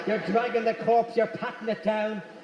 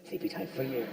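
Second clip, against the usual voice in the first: soothing hush; 7 semitones lower, 10 dB quieter than usual. Retold or imitated. Soothing wife back to sleep.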